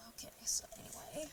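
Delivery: whispering. Real, not acted. Whispered aside.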